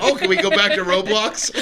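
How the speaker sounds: high laughing mock whine of bored kids; kids whining, laughing. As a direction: squeal it through laughter like an impatient kid. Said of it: "They're like".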